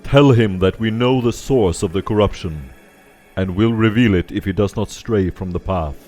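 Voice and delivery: booming voice. Acted. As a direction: proclaim it like a giant.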